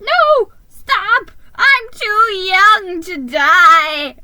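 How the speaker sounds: high screaming terror, slow at the end; panicked scream. A narrator's reading. Mouse panics in the sleigh.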